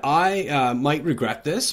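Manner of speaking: usual speaking voice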